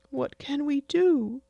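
put-on high childish pleading voice; whiny little boy explaining. Son pleading with his mother, high and childlike.

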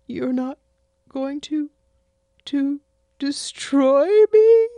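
put-on high childish pleading voice; whiny little boy explaining. Son pleading with his mother, high and childlike.

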